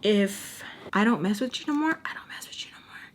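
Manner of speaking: unvoiced-sounding, very soft whisper. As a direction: whisper it under your breath. Mouthed-under-breath line in a retold story.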